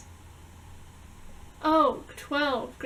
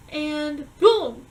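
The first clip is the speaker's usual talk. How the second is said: loud boom; 8 dB louder than usual. Real, not acted. Sudden scare in dream story.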